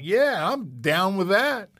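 retold easygoing yes; cool acceptance. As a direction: play it cool but pleased. Accepting the hundred-an-hour offer.

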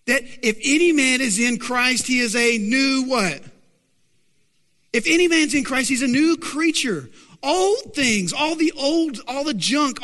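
high, emphatic preacher's proclamation; full-force preaching. Real, not acted. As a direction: build the verse like a rallying cry.

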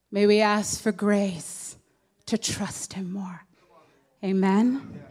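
prayer. Real, not acted. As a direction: pray gently over the congregation. Leads a short prayer.

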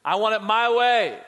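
a child throwing a fit, hammed-up; mock selfish toddler. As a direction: play a greedy child demanding his way. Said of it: "came out of the womb saying".

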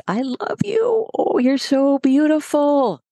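put-on high cooing baby talk, slow I love you. Shown as a demonstration. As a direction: coo it like talking to a puppy. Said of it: "if you want to make the baby voice".

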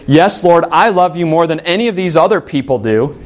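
self-assured, emphatic declaration; confident boast. Acted. Peter bragging to the Lord of loving him most, confident. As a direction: say it boldly, full of ego. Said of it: "Gone was the confidence. Gone was the ego".

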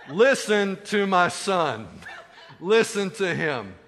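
loud, slow, booming command; God's commanding voice. Acted. God silencing the disciple from heaven, loud slow command. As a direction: order it slowly, every word weighted. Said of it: "polite way of God saying".

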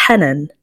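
Hanan with short fronted vowels, like HEN-en; Arabic pronunciation. Shown as a demonstration. Host demonstrating how Arabs say her name, fronted Arabic "Hanan". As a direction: say "Hanan" the Arabic way, a's near e. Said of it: "and Arabs pronounce it as".

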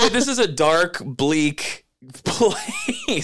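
amused outburst; laughing. Real, not acted. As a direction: laugh through the last word. Laughing exclamation.

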